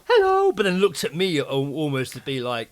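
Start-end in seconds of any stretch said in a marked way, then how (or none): 0.1-0.5 very high, loud squeaky greeting; high hello. Retold or imitated. Imitating the dog walker's high, loud hello again. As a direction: pitch it way up like talking to a pet. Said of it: "she was like".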